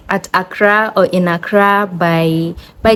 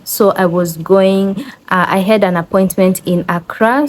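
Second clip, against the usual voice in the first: ordinary delivery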